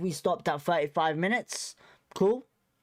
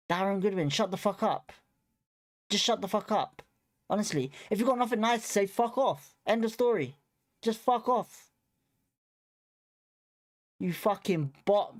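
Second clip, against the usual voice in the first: annoyed telling off; 3 semitones higher than usual. Real, not acted. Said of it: "I'm allowed to be upset".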